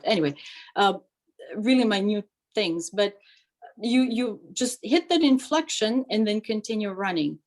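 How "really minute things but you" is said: usual speaking voice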